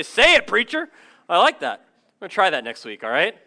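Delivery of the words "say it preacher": high, loud call back to the preacher; congregation call-out. Pretend. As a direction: cheer the preacher on, call-and-response. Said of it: "and you all should say".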